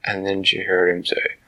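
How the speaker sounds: ordinary delivery, nothing marked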